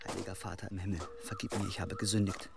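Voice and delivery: very soft, low murmured confession; hushed prayer. Acted. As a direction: confess quietly so no one hears. Whispered confession prayer alone. (leises, gedämpftes Beichtgebet)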